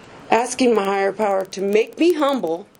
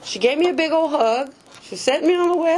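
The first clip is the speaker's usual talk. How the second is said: ordinary talk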